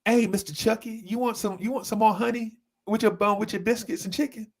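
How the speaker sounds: high, sugary sweet customer-service voice; extra friendly cashier. Retold or imitated. To a regular customer, high sweet cashier voice. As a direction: play the overly friendly register lady. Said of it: "she'd be like".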